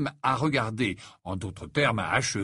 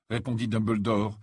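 usual speaking voice

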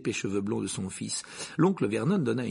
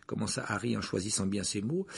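ordinary delivery, nothing marked

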